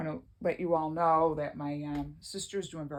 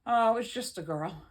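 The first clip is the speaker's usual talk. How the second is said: dismissive; 7 semitones higher, 7 dB louder than usual. Retold or imitated. Father dismissing the new baby.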